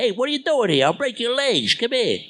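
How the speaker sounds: Jersey tough-guy threat; James Gandolfini impression. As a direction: lean forward and push it through the nose like Gandolfini. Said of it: "He was always a bit like this".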